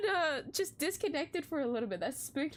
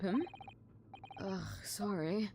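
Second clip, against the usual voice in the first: ordinary delivery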